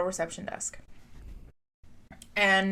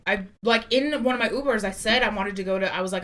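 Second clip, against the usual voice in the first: normal talking voice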